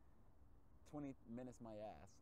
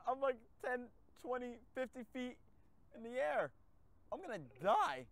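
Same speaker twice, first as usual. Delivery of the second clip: mock panic; 11 semitones higher, 7 dB louder than usual. Mock panic flying car.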